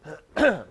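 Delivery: throat clear from inhaled dust; coughing on dust. Real, not acted. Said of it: "I inhaled some dust or something".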